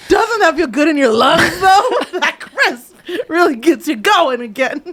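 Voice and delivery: high, laughing sales pitch; laughing mock cheer. Wife praising freezing air to shivering husband, high and laughing. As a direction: gush about the cold air while laughing.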